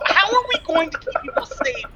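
loud, high exasperated rant. Acted. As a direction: vent at the useless party. Frustrated at the locked door.

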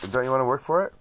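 ordinary delivery

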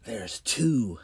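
ordinary delivery, nothing marked

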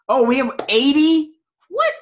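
high, loud startled question; surprised. Real, not acted. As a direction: blurt it out in disbelief. Reading huge fart ratings.

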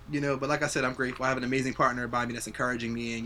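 ordinary talk

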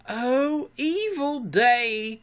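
high, drawn-out lament; wailing cry. A narrator's reading. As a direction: bemoan it slowly and theatrically. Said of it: "cried Starkey".

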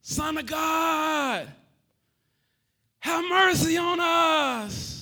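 slow, high, loud calling out; drawn-out shout of desperate lepers; lepers hollering. Retold or imitated. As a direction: cry out for mercy from afar. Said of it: "these lepers holler".

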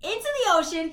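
loud, high, incredulous retelling; worked up, loud storytelling; excited exclamation. Real, not acted. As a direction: shout out the big plot twist. Climax of the retold plot.

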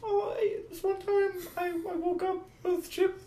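high, slow whiny voice; Austin impression. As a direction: squeak it out in a bad mock Austin voice. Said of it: "That's Austin's voice".

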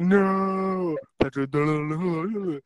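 high, hammed-up cartoon wail; high, loud mock-tearful pleading; mock crying. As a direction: wail it in pretend despair. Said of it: "crying face".

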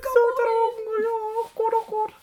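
very high squeal, nearly crying with laughter; helpless laughter. Real, not acted. Co-host lost in giggles over dry wine, high tearful laughter.

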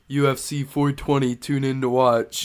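loud fight-night announcer hype. Retold or imitated. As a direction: announce it like a promo. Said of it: "Cody had the great idea".

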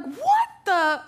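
high, alarmed retold yelp; startled exclamation. Driver reacting to something hitting her eye, startled. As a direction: exclaim as if something just hit you.

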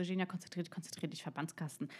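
hushed inner pep talk; self-coaching. Retold or imitated. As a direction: urge yourself to focus under your breath. Retells telling herself to focus.

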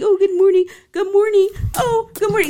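high sing-song lovey voice; mock the cutesy couple; mock sweet greeting. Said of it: "sit here and say".

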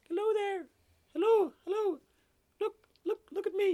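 tiny, very high squeaky frog voice; little voice. Acted. Frog calling up to a golfer, loud little high voice. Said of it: "he hears this little voice".

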